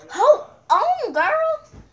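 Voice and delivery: high, loud panicked yell; panicked shout. Acted. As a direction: shriek for her to wait. Begging before getting hit.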